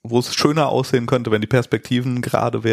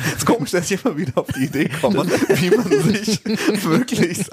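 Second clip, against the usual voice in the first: laughing; 7 semitones higher than usual. Real, not acted. Laughing at his own repeated guess.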